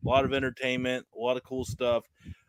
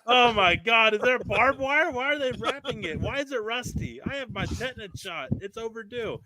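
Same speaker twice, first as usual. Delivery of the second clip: hammed-up alarm, much higher; mock panic; 9 semitones higher than usual. Host pretending to panic over a rusty barbed-wire bat, mock fright. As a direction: squeal it like a pretend coward.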